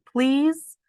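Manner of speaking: high, loud whining "Please?"; begging please. Acted. Sister begging brother to go first, whiny plea. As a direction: plead like a little sister.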